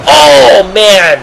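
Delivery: high, drawn-out "oh", falling away; commentator's big shocked reaction; excited exclamation. Real, not acted. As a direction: cry out at a hard slam. Reacting to a repeated slam.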